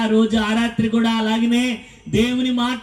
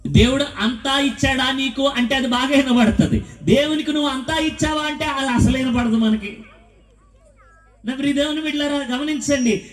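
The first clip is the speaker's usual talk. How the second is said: forceful preaching; 6 semitones higher, 3 dB louder than usual. Acted.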